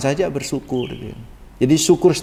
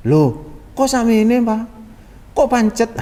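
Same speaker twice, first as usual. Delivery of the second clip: loh kok sama ini Pak, pitched high in mock dismay; a wife's let-down whine about the same amount; disappointed complaint; 7 semitones higher than usual. Wife complaining to her husband about the money, disappointed. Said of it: "Kecewaan kan".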